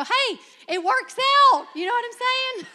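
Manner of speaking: high, loud, gleeful punchline; delighted. Real, not acted. Preacher ends her funny Spencer story, high and loud with glee. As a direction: burst out with delight at the happy ending.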